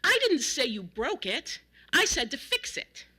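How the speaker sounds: put-on loud scolding, as at an unruly child; loud, exasperated telling-off; scolding shout. A narrator's reading. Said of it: "shouted at James as if he was a particularly unruly child".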